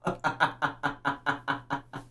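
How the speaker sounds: soft fading real laugh; amused laugh dying away; trailing laugh. Real, not acted. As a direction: laugh it out under your breath.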